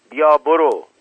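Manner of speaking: loud, high dismissal; angry shout. A narrator's reading. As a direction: angrily shout someone away. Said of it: "عصبانی داد زد". (داد عصبانی)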